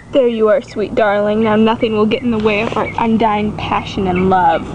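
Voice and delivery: put-on deep boy voice. Boy breaking up with Shannon, low and dramatic. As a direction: drop the voice into soap-opera earnestness.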